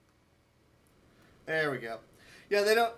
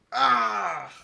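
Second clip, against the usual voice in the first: frustrated cry; 3 semitones higher, 8 dB louder than usual. Real, not acted. Frustrated at missing the time.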